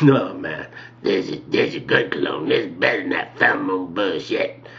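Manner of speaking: low put-on old man's voice; old guy. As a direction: drop the pitch to an old attendant's sales pitch. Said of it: "The old guy".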